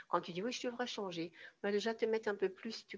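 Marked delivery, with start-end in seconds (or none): 0.4-1.3 lifted, softly tentative resolve; a bit hesitant. Acted. Coach acting out someone who knows she should change, a bit hesitant. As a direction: lightly voice a half-convinced admission. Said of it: "un peu hésitante".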